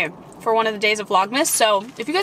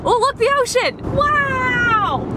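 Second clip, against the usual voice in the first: high-pitched amazed exclaim; excited; 12 semitones higher, slower than usual. Pretend. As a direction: gasp at the view, pitch way up. Excited seeing the ocean.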